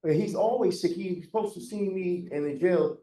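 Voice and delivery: loud, high-pitched complaint; coming on at full intensity. Real, not acted. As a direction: push in loudly over the other speaker. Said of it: "you're coming at me at a hundred".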